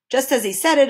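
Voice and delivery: ordinary delivery, nothing marked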